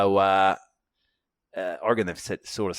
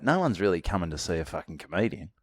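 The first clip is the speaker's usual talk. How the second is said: usual speaking voice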